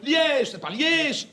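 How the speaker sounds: LIÈGE stretched out, high; indignant correction. Acted. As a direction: hit both takes of Liège slowly, correcting. Correcting the pronunciation.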